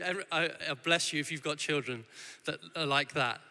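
ordinary talk, nothing marked

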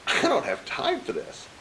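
high, slow exasperated protest; strained mock complaint; frustrated. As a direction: re-enact pleading impatience without raising the volume. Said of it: "I'm all frustrated".

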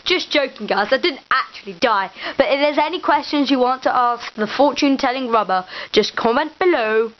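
loud, energetic sign-off; loud vlog outro. Acted. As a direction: address the viewers loudly.

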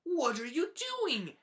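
three friends calling after Pig; curious friends. Acted. Hen, Duck and Goose call to Pig, high and loud.

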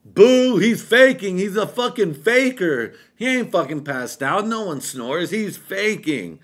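jeering shout, heckling; mocking shout. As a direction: yell at him for faking it. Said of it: "my cousin is still like".

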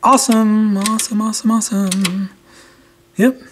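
real relief as the needle moves; excited. Real, not acted. Meter finally shows activity.

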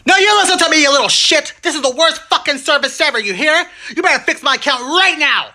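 high, loud mock rage; furious outburst. Agent screaming at a customer, high mock fury. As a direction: lose it at the customer.

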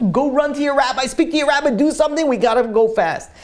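high, hurried urging; acted urgent command. Doctor rushes husband to his rabbi, frantic. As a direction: send him off urgently, pitched high.

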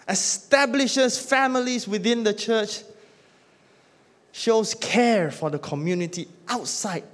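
rising pulpit call for a church that loves; full-force preaching. Acted. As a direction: preach it to the back row with fire.

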